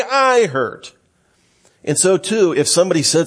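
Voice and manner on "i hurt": much higher, pitched up to act out the hurt; acted pained cry, pitched well up; mock cry of pain. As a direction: voice the cry of someone shot. Illustrating crying out in pain.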